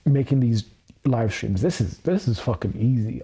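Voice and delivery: normal talking voice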